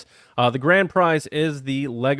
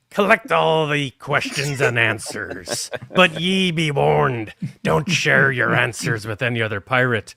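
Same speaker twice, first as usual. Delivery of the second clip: pirate voice; 4 dB louder than usual. Pretend. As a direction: ham it up like a pirate. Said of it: "where's the pirate voice?".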